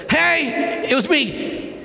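high-pitched acted call; calling out a confession. Achan owning up to the people, calling out. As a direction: pipe up across a crowd.

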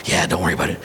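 low-pitched, offhand reassurance; dismissive dad. Acted. Father waving off the clan news, low and dismissive. Acted dad brushing off again.

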